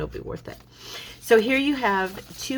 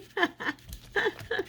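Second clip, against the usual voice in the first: soft high giggle; breathy giggle; 5 dB quieter than usual. Real, not acted. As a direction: giggle at yourself. Laughs at crooked fold.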